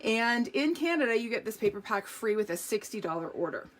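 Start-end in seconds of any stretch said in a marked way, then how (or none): none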